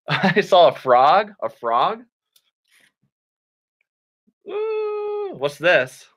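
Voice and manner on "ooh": high, soft drawn-out howl; playful high "ooh"; wolf howl. Pretend. Howls before showing timber wolf.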